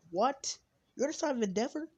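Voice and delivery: high, loud incredulous question; stunned disbelief. Retold or imitated. Friend finds out who his father is, stunned disbelief. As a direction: squeal it in shock. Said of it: "it's like".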